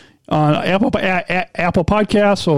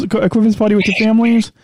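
ordinary talk, nothing marked

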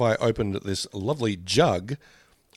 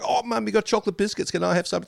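put-on excited child; 10 semitones higher, faster than usual. Kid begging mum for biscuits.

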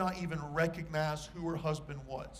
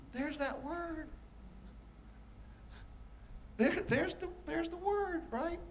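There's that word, pitched much higher and softer with rising delight; rising thrill at finding the word; excited; 12 semitones higher, 5 dB quieter, slower than usual. Acted. Preacher pointing the congregation to the word, excited and high.

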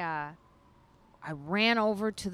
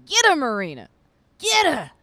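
crowd cheering; 9 semitones higher, 13 dB louder than usual. Retold or imitated. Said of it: "they're like".

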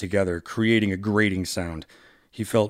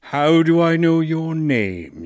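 slow, louder and higher villain line; 8 semitones higher, 5 dB louder, slower than usual. Acted. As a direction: loom over him with the question. Villain monologue read aloud.

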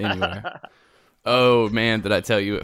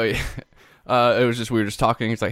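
normal talking voice

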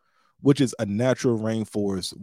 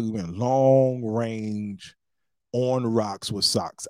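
slow mock-epic emphasis; slow dramatic drawl; much slower than usual. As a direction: stretch every word slowly.

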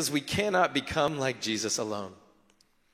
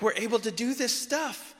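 mock celebrating; 8 semitones higher than usual. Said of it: "They come back celebrating".

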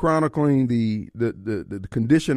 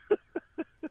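high laugh; 9 dB quieter than usual. Real, not acted. Host laughs at story.

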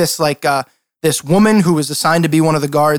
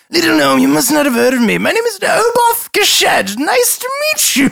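flamboyant noble swooping up in pitch; pompous lord; 11 semitones higher than usual. Acted. Lord introduces himself to the gnome, pompous.

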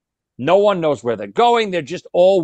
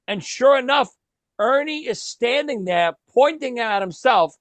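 ordinary talk, nothing marked

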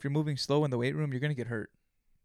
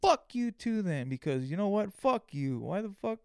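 inner rant; 6 semitones higher than usual. Retold or imitated. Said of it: "in my head, I'm like".

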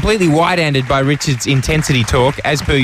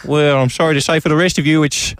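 Bob Franklin; 2 semitones higher than usual. Acted. Actor addressing his trapped theatre audience, half-hearted celebrity impression. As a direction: play a deadpan stage showman with slurred esses. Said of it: "attempting a Bob Franklin impression".

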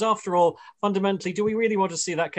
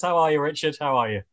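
normal talking voice

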